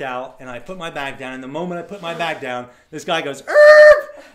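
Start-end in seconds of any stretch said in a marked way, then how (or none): 3.5-4.2 very high, very loud sudden yelp; a startled squeaky shout; sharp yelp. Retold or imitated. Guy calls out sudden noise.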